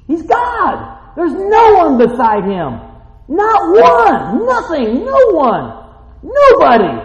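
loud, high, shouted proclamation; fiery pulpit cry, each word hammered; preaching at full force. Acted. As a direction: thunder it out to the church. Preacher exclaims about God.

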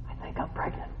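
hushed whisper of a secret; retold whisper. Deli worker confiding her news, whispered. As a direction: whisper it like a confession. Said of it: "she goes".

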